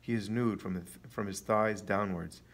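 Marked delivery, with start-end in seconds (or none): none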